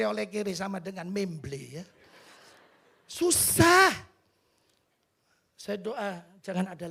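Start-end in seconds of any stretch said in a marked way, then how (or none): 3.1-3.9 sudden high, loud cry of the word; exclaimed. Acted. Pastor crying out to the congregation about hardship, loud. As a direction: burst out with it. Pastor recalls being poor.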